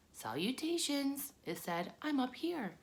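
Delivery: small voice. A narrator's reading. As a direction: greet him like a tiny hidden spider. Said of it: "heard a small voice".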